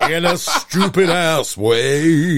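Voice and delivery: slow, drawn-out put-on voice; mock quoting. Friend repeats the mocked lyric, slow and camp. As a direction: drag out each word with a sneer.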